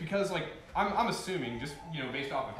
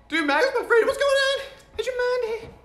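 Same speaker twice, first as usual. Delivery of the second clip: excited greeting; 11 dB louder than usual. Real, not acted. Greeting follower on stream excitedly.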